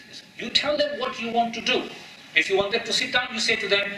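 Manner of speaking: ordinary talk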